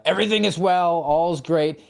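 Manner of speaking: half-hearted "Everything is well"; mock Kevin Bacon. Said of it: "So Kevin Bacon".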